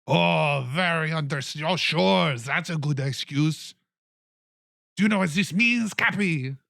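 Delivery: mocking, loud and high; mayor scoffing at Cappy's story; high, loud sarcastic mayor. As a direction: sneer at the flimsy excuse.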